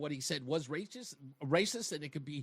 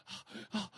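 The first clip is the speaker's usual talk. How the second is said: mock shocked gasp; 3 semitones higher, 9 dB quieter than usual. Mock reaction of audience staring.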